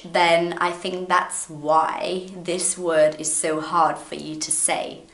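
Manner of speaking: ordinary talk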